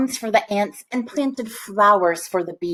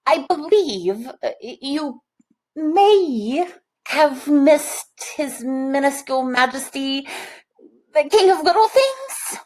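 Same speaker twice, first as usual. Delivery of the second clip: higher, hesitant and fearful; timid steward; 6 semitones higher than usual. A narrator's reading. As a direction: break bad news to the king nervously. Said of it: "raised a trembling hand".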